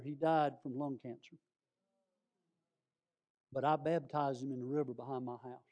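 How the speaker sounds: ordinary talk, nothing marked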